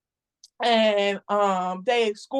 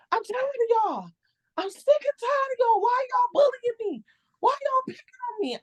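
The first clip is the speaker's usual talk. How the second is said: much higher, cracking retold outburst; snapping at the boss. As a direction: relive the breakdown, voice strained high. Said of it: "I broke right then and there. I snapped".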